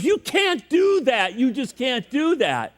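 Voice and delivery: high, drawn-out mock alarm; alarmed protest. Friend objects to shooting the mule, mock-alarmed protest. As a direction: plead in hammed-up disbelief, pitch way up.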